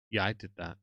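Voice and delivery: smug, self-satisfied mumble. Retold or imitated. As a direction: act pleased with yourself. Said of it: "there's a look on her face of".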